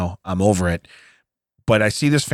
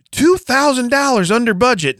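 mock boast; 11 semitones higher than usual. Mocking the renovators' boast.

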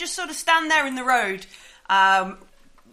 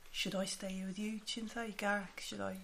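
hushed question; 4 semitones lower, 19 dB quieter than usual. Acted. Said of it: "Artus is like".

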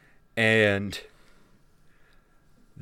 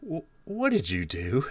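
confused; 2 semitones higher than usual. Acted. Confused villain's spell fails.

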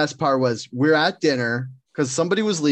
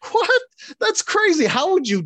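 amazed. Retold or imitated. Retold coworker amazed.

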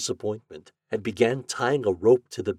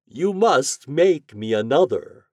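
high, loud, slow wizard voice; theatrical raised-voice wizard's demand; 6 semitones higher, 8 dB louder, slower than usual. A narrator's reading. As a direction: demand it grandly with stretched words. Wizard demands another dog.

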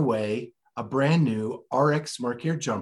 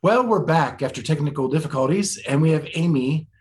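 louder upbeat restart; hyped return; 3 semitones higher, 6 dB louder than usual. Real, not acted. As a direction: bring the energy back up. Restart after technical difficulties.

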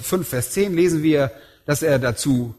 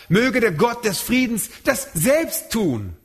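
"selbst" held long and falling, line slowed; stress on selbst; 6 semitones higher than usual. Shown as a demonstration. Preacher quotes Paul to stress God acts alone, stress on selbst. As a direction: lean slowly into "selbst". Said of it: "emphatisch, also zur Betonung".

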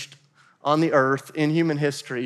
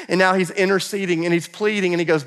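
usual speaking voice

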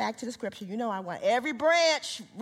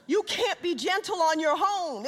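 raised, ringing sermon voice; preaching at full force; 10 semitones higher, 4 dB louder, slower than usual. Acted. Preacher proclaiming fruits of the Spirit, raised and ringing. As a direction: drive each fruit home with fervor.

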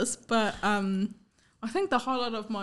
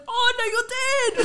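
mock teacher; 13 semitones higher, 6 dB louder than usual. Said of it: "And he goes".

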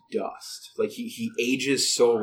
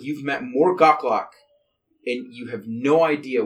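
ordinary delivery

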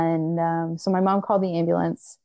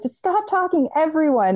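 much higher, exasperated cry; frantic snap; 10 semitones higher than usual. Retold or imitated. Woman in labour telling her brother to shut up, shrill and frantic. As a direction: shriek at him to stop talking. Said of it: "And I was frantic".

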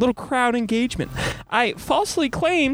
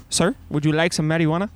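a fast, dead-straight repeat of the offer; deadpan; 4 semitones lower, faster than usual. Retold or imitated. Vendor repeating his marijuana offer to the dad, deadpan. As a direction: say it stone-faced, as if to the camera. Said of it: "He goes deadpan right into the camera".

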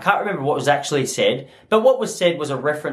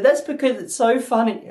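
imitating a wife holding back tears, higher voice; mock tearful; 6 semitones higher than usual. Wife claiming she cries from laughing, mock tearful. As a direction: put on a high, lightly tearful voice. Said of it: "made it sound like you're bawling your eyes out".

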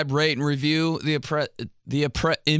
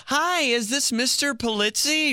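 higher, slower, overly formal caller voice; polite phone caller; 12 semitones higher, slower than usual. Retold or imitated. Unknown caller on the phone asking for him, overly polite. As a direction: play the stranger on the phone, sounding very proper. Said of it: "They're like".

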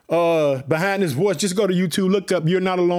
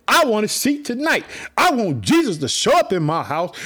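usual speaking voice

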